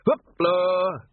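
loud, high showman's call; shouted hoopla. Acted. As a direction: call the animals to pose. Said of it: "and shouted".

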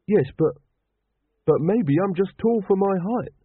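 plead, grasping at any hope; desperate pleading. A narrator's reading. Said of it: "said Carrot desperately".

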